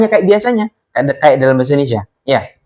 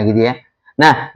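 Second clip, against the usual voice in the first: normal talking voice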